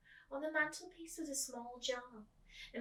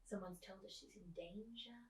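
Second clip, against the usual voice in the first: soft unvoiced whisper; dramatic whisper of the danger; 9 dB quieter than usual. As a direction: whisper it like a secret.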